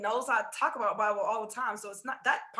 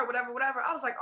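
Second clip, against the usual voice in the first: ordinary talk, nothing marked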